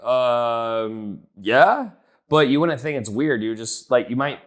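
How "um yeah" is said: drawn-out "um", then a rising, questioning "yeah"; unsure agreement, pitched up and louder at the end; hesitant yes. Acted. Prospect answers an odd gym question, hesitant yes. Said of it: "you might be kinda like".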